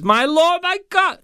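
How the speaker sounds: very high-pitched startled outburst; acted cry of astonishment; mock exclamation. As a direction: blurt it in hammed-up shock. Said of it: "they're saying that's an exclamation".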